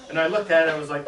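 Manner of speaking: normal talking voice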